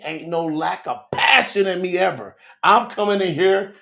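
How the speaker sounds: normal talking voice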